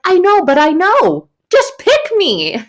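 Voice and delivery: put-on high, loud, frantic kid begging to be picked; eager kid. Eager pupil begs teacher to pick them, high and loud. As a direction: squeal it like a desperate kid in class. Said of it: "And they're like".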